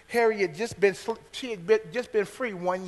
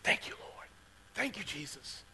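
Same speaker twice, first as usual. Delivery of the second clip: hushed prayer; 12 dB quieter than usual. Retold or imitated. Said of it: "he just grabbed me".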